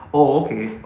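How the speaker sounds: ordinary delivery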